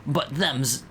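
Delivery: unhurried put-on British voice; British accent. Bug explaining roach eating to a stranger, British accent. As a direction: play a British bug, taking your time. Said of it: "This is my British voice".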